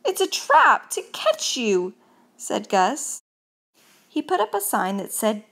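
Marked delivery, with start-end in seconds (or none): 0.0-1.9 high, loud alarmed warning; urgent warning. A narrator's reading. Gus warns Mouse about the trap, urgent warning. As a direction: warn them urgently, voice raised.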